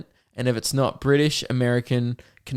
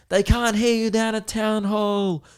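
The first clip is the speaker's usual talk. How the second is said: hype MC callout; 9 semitones higher, slower than usual. Retold or imitated. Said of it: "He goes".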